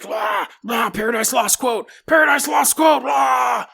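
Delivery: shouted demon gibberish, rising high; mock demon. Possessed boy ranting at the room, shouted mock demon. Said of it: "And he's like".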